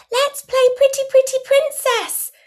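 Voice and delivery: very high, loud excited squeal; squeaky princess-game excitement; child's big cheer. A narrator's reading. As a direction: erupt like a delighted little girl. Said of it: "You erupt with big cheers".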